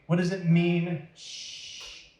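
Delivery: usual speaking voice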